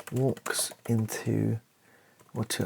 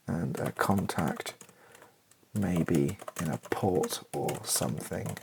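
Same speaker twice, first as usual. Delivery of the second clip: normal talking voice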